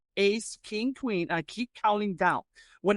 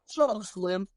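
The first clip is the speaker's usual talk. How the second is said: ordinary delivery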